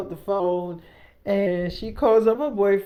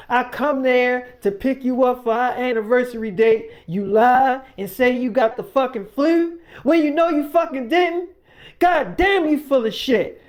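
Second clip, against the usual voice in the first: raised, higher-pitched mock outrage building; imitated heated accusation; retold angry rant; 4 semitones higher, 5 dB louder than usual. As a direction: act out a boyfriend fed up with lies. Acted boyfriend's angry accusation.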